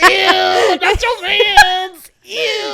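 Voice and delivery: high loud drawn-out ew; mock disgust. Teasing her friend about her boyfriend, mock-disgusted squeal.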